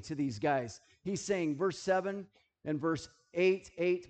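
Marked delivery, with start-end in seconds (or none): none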